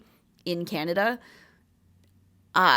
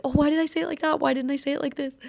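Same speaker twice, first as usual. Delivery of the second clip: higher, exasperated second-guessing; self-scolding; 7 semitones higher, faster than usual. Pretend. After ending the podcast, higher exasperated self-scolding. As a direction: fret over your own words. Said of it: "I'm gonna be like".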